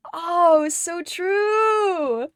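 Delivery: ordinary delivery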